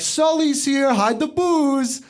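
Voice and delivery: high, loud, slowing on hide the booze; mock the guests' warning; high put-on party voice; mocking partygoer voice. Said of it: "they're like".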